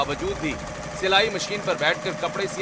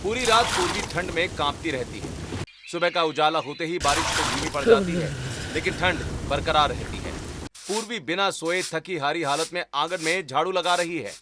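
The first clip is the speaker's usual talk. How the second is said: story narration. A narrator's reading. Narrator describing the sister's night on the roof, storytelling. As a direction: narrate the scene calmly.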